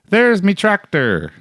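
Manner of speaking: put-on high, slow East Coast fisherman accent. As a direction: drawl it like a Maritime old-timer. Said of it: "he was quoted to say".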